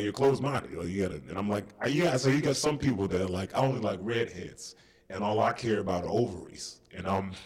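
put-on comic voice. Comedian defending himself over dating joke, in soft put-on voice. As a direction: hold back behind a silly little voice. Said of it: "if I say it in this voice".

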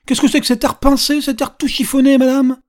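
high, loud mock scolding; exclaiming old man poking fun; good-natured teasing. A narrator's reading. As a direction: tease her loudly without malice. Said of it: "c'était dit avec un tel naturel".

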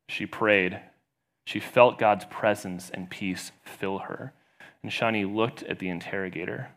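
ordinary talk

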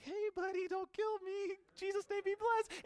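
very high, squeaky scared voice; timid Ananias. Acted. Ananias approaches the man who killed Christians, timid falsetto. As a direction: plead in a comic falsetto. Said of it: "he goes over to Paul and he's like".

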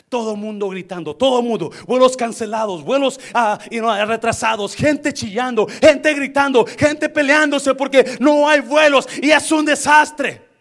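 loud, high, building to a shout; forceful sermon crescendo; rousing preaching. Real, not acted. Preaches the airport chaos at force.

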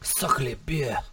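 soft, badly faked French accent; quiet attempt at a French accent. Shown as a demonstration. Asked to show a French accent, faking it softly. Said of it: "let me hear your French accent".

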